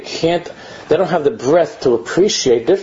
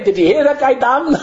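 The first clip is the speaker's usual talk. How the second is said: higher, eager mocking gossip; gossiping kids; 8 semitones higher than usual. Kids gossiping all day about the prayer leader, high mocking voice. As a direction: voice an amused child spreading the story. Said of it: "They can talk about the whole day".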